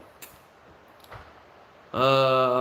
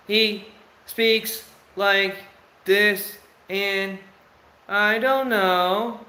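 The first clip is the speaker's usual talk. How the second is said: very slow, higher, drawn-out mimicry; imitating slow speech; 8 semitones higher, 3 dB louder, much slower than usual. Father mimicking his slow-talking son for viewers, very slow. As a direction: drag every word like the slow-talking son. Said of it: "But he speaks very slow".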